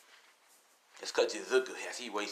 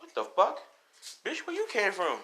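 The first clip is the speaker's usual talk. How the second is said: startled outburst; 10 semitones higher than usual. Retold or imitated. Girl shocked by the sudden visitor, higher startled outburst. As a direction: blurt it out, caught off guard.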